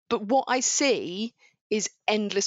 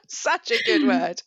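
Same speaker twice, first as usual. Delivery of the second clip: laughing; 6 semitones higher, slower than usual. Real, not acted. Laughing about the word bunker.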